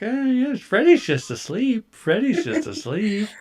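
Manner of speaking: high, slow, unhinged reassurance; sing-song cover-up of a dead cat; crazy denial. Pretend. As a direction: owner hiding the dead cat, high and odd. Pretending the dead cat sleeps.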